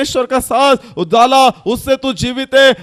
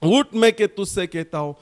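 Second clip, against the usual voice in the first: dropping much softer and lower; fading soft command; 5 semitones lower, 6 dB quieter than usual. Acted. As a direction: let the command fall to a hush. (धीमी होती आवाज़)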